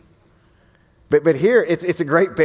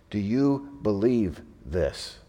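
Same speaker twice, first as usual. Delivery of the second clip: soft personal question; 7 semitones lower, 8 dB quieter, much slower than usual. Real, not acted. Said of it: "preacher asks congregation personally".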